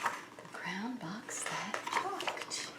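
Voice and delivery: slow unvoiced whisper. Showing the title page, whispering the title slowly. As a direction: hush it like a secret, slowly.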